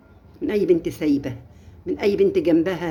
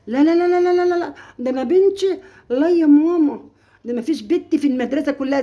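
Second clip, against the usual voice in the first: high, loud mock denial of a mother; hammed-up high protest; mocking a doting mother; 9 semitones higher, 5 dB louder than usual. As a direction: squeal it out like a blind-trusting mom. Mother imitated, denying her daughter.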